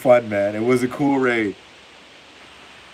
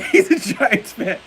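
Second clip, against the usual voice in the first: higher, laughing through it; 12 semitones higher than usual. Real, not acted. Streamer to chat, still laughing at the Giants fan. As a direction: giggle it out. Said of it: "Pardon me".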